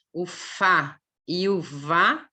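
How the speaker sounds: "fá" and "vá" said slowly to show the small difference; phonics demo. Shown as a demonstration. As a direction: draw out "o fá e o vá". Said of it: "vocês percebem". ("o fá e o vá" pausado)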